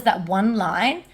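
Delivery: usual speaking voice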